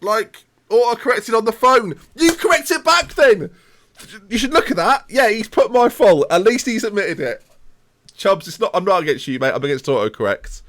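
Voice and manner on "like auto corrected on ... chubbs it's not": higher, louder exasperated rant; mock rant. Real, not acted. Streamer ranting at chat over his misspelled name, higher and louder. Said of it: "I'm so angry".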